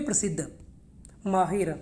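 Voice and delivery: ordinary talk, nothing marked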